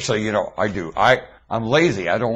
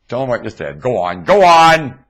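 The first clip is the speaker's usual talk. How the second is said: usual speaking voice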